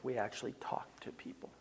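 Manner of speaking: whispered aside; confiding whisper. Shown as a demonstration. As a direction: lean in and whisper it. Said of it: "whispered point".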